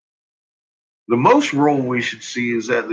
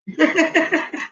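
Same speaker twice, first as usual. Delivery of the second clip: high laugh; 13 semitones higher than usual. Real, not acted. Laughing at the chart.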